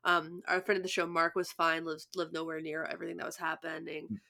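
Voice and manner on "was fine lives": ordinary talk, nothing marked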